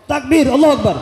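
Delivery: takbir keras dan tinggi; seruan takbir. Real, not acted. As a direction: serukan takbir sekuat tenaga. Memimpin takbir jamaah. (penceramah mengajak jamaah bertakbir, berseru keras)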